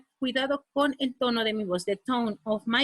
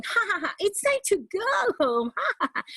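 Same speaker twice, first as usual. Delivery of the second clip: high, giggling cheerful take; bright laughing demo of the line; laughing happily; 8 semitones higher than usual. Shown as a demonstration. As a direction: laugh through it like nothing's wrong. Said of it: "for example, when we're worried, we're not going".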